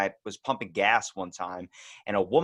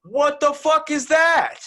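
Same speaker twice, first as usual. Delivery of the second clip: much higher, louder, slow disbelieving yell; hammed-up outrage at the gas law; incredulous shout; 11 dB louder, slower than usual. Reacting to the Jersey gas story, a slow hammed-up shout.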